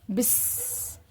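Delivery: soft unvoiced buzz; buzzing bee. Shown as a demonstration. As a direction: breathe a bee's buzz. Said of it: "बीज की sound कैसी होती है". (धीमी फुसफुसाती भिनभिनाहट)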